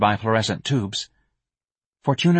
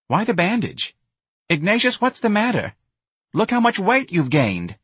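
put-on much higher, brash young woman scolding; shocked Myrna; 7 semitones higher than usual. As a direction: blurt it out, half appalled half bossy. Myrna reacts to Ignatius.

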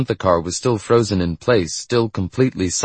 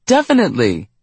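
eager cry, a bit higher; cried out; 4 semitones higher, 4 dB louder than usual. A narrator's reading. Young rider agreeing to teach him, crying out. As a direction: call it out eagerly. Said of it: "she cried".

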